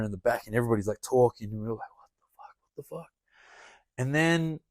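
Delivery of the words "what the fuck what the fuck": stunned whispered disbelief; retold whisper. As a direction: whisper it in shock, as in the back seat. Retold shocked whispering in back seat.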